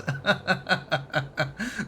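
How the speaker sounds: rising laugh after a joke; amused laughter; real laugh. Real, not acted. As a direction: chuckle it out.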